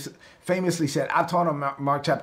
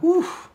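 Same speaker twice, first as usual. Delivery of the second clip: relieved gasp; 3 dB louder than usual. Acted. Forgiven debtor's relief.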